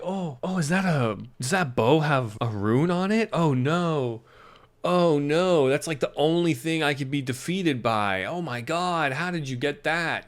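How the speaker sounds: mock surprise as a cartoon villain; mock villain shock. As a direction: play the villain who suddenly realises. Villain acting shocked by rune.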